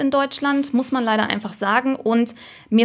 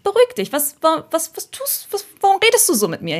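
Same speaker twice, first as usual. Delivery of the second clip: higher-pitched mock indignation; indignant young user; 6 semitones higher than usual. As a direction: play an affronted user telling off the app. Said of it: "die sich einfach sagen, „Hey". (gespielt empörter Nutzer, hohe Stimme)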